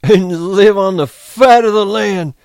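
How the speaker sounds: high, loud and slow with joy; happy dreamy Lenny. Acted. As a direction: savor every word of the dream. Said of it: "Lenny giggled with happiness".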